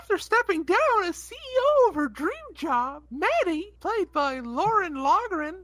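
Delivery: lightly high elf reading voice. Acted. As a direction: read it like a chirpy elf. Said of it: "sound like a elf and a clown".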